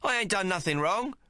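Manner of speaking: high, pleading yell; yelled protest. Acted. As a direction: shout your innocence. Yelling while dragged away.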